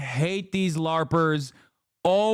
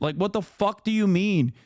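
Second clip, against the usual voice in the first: normal talking voice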